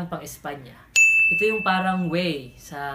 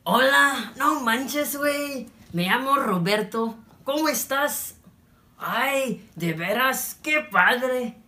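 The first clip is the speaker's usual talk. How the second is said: high, loud, drawn-out Mexican Spanish; Mexican accent; 7 semitones higher, 4 dB louder, slower than usual. Shown as a demonstration. Teacher turning plain Spanish into Mexican slang, exaggerated Mexican accent. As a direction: play up a lively Mexican accent. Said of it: "magiging Mexican na".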